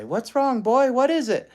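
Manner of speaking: high, loud worried call to a dog; worried owner. Retold or imitated. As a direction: ask the dog urgently what's wrong. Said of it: "They'd say".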